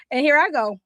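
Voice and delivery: usual speaking voice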